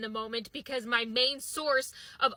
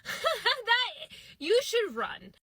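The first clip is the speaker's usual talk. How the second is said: mock threat; 9 semitones higher, much slower than usual. Threat to friend after confession.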